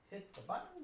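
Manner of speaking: whispered aside to himself; whisper to self. Real, not acted. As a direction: breathe it off-mic. Host muttering to himself.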